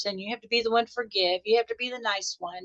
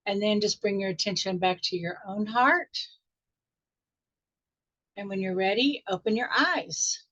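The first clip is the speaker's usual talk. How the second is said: guided meditation. Acted. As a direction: ease the group gently back into the room.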